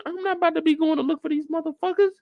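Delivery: high-pitched, hurried protest; Perry's refusal. Retold or imitated. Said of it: "Perry said".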